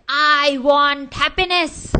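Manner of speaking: storyteller yells out the rich man's demand; yelling. Retold or imitated. Rich man yells at the saint for happiness, loud and slow. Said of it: "that rich man yelled and he said".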